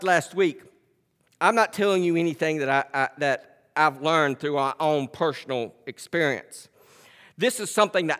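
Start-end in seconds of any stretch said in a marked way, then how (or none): none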